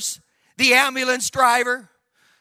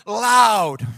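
"loud" drawn out with force; emphatic stretched word; 5 dB louder than usual. Real, not acted. Preacher telling how he spoke in tongues, "loud" stretched out. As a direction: swell on "loud" and hold it.

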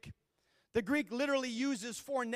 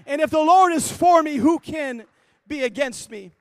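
shouted, higher and much louder declaration; full-force preaching; 5 semitones higher, 18 dB louder than usual. Acted. Preacher leading brothers and sisters to declare it, shouting. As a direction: roar it out as a battle cry of faith.

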